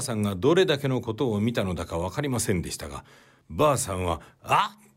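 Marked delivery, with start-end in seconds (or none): 4.4-4.9 startled cry. Acted. Old woman sees the killing.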